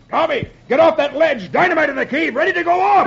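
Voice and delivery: high-pitched alarmed warning shout; urgent yell. A narrator's reading. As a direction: yell a danger warning across to your son. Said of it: "and Hardy yells".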